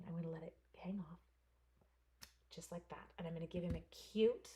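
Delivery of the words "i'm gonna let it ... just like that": whisper. Real, not acted. Crafting aside to camera.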